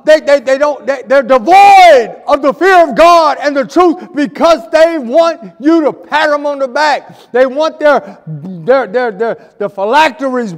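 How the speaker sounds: loud, high fiery preaching; preaching shout. Real, not acted. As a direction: thunder it at the congregation.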